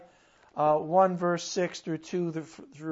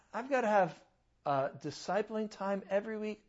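ordinary talk

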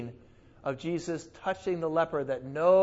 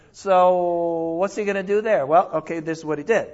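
ordinary talk

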